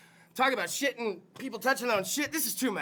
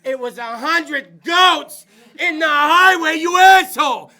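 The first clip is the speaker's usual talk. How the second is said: slow furious shout, much louder and higher; mock angry excuse; 4 semitones higher, 15 dB louder, slower than usual. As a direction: yell the excuse at the boss. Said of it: "I'm like".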